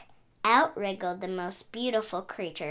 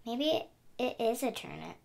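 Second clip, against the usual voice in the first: sighing; 7 dB quieter than usual. Acted. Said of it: "Pig sighed".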